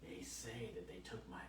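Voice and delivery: hushed, mimicked patient whisper; conspiratorial whisper. Patient confides in husband about her eggs, conspiratorial whisper. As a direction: whisper it like a suspicious secret.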